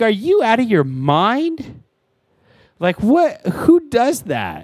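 high, slow incredulous outburst; outraged disbelief. Retold or imitated. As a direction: retell the shock at the barista, drawn out high. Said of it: "I'm like".

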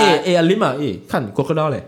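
mock Malay accent. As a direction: call out to a friend in a hammed-up Malay accent. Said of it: "you know how they sound lah".

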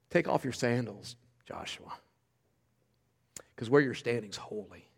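very low, soft scripture voice; hushed solemn command. A narrator's reading. The Lord's commander addresses Joshua, hushed and solemn. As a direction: let the holy words drop low and quiet.

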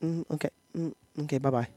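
soft, polite phone sign-off; mom's telephone voice. Acted. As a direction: imitate mom softly wrapping up a call. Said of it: "She has a telephone voice".

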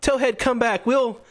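put-on high, earnest child's voice; kid trainer. Young trainer recalling his beaten Pokemon, earnest. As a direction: call your Pokemon back in a high, gentle kid voice. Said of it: "and he's like".

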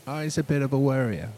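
imitated English accent. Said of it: "English would say".

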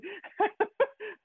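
a burst of high laughter. Real, not acted. Teacher laughing at her own library story, high laugh.

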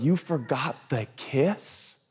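very slow, drawn-out skeptical question; wife questions husband, very slow and skeptical. Retold or imitated. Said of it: "And she goes".

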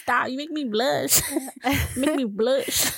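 mock-flattered, laughing between phrases; giggly and bashful. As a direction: play coy, drawing out the last phrase. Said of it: "I want men to giggle".

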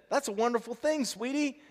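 very high-pitched, pleading delight; baffled father. A narrator's reading. Father trying to cheer his crying daughter, baffled. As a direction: voice way up, puzzled and coaxing.

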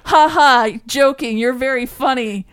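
loud, high mock laugh into retort; imitated kid's joking retort. Parent acting out daughter catching the homework joke, loud high imitation. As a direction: play a child calling out a joke. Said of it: "looked at me for a second and then went".

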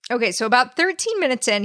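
normal talking voice

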